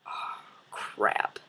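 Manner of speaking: breathy whispered dismay; whispered oh crap. Retold or imitated. Scared rider whispering when the ride breaks, hushed. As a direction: hiss it under your breath. Ride stops, worried aside.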